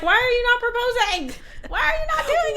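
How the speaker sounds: high whiny mock complaint; mock impatient bride. Host imitating the waiting bride, high whiny mock. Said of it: "Meanwhile, you're like".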